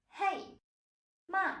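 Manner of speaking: "Hey" and "Ma" high, falling sharply; mock irritated falling tone. Shown as a demonstration. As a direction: say "Ma" like an irritated "Hey", without yelling. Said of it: "you yell at someone".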